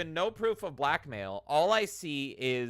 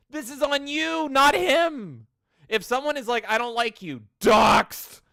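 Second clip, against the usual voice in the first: higher, louder exasperated rant; indignant raised voice; heated rant; 8 semitones higher, 7 dB louder than usual. Real, not acted. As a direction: lay into them, fired up.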